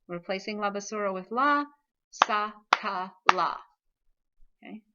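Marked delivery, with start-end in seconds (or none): 2.1-3.6 "saca la" slow, in three separate beats; syllable by syllable. Shown as a demonstration. Teacher showing where the accent goes, "saca la" slowly syllabified. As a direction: break "saca la" into sa, ca, la. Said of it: "Third to the last syllable".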